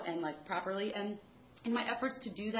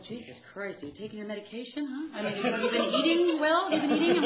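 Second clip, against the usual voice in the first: mocking stepfather; 5 semitones higher, 9 dB louder than usual. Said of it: "my stepfather's like".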